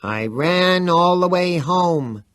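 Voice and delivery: high, slow hammed-up falsetto croon; mocking falsetto. A narrator's reading. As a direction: sing it like a cheesy pop ballad. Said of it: "dreadful little Antony falsetto".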